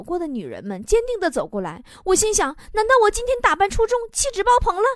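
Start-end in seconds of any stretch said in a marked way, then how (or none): 2.7-4.8 high-pitched pleased disbelief; self-flattering wonder. Acted. Man seeing a beauty walk straight over, high preening wonder. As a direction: think it aloud, pitched up with mock vanity.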